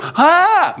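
high delighted gasp of joy; a thrilled "Ah!" as if seeing the risen Jesus; overjoyed cry. Acted. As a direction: burst out with sudden joy.

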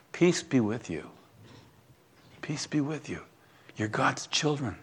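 gentle hushed blessing to the congregation; soft blessing. Real, not acted. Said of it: "Listen, children, if I may".

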